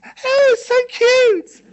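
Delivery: put-on very high, slow cooing over a cute child; cooing at children. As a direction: coo it way up high and slow, like to a baby. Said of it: "they raise the voices".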